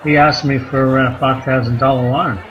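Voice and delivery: usual speaking voice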